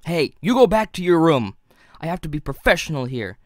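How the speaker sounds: the reviewer barking at his inner fanboy; scolding. Acted. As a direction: shoo the intruder back to his room.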